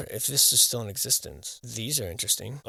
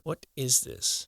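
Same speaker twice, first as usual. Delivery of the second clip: ordinary delivery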